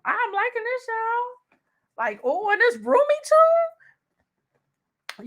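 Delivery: high, slow delighted coo; high delighted drawn-out praise. Real, not acted. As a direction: coo over the roomy bag. Delighted with the bag.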